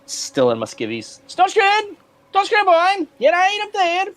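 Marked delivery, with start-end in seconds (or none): 1.3-4.1 loud, high urgent call up the ship. Acted. Kai yells for his goblin companion to climb up, loud and urgent. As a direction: shout for him across the deck.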